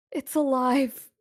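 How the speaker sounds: loud, high, desperate repeat; terrified warning. Acted. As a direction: raise it to a frightened, forceful cry. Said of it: "and she looks terrified".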